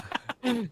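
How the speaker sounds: high, quiet laugh. Real, not acted. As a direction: burst of laughter. Host laughs at son's story.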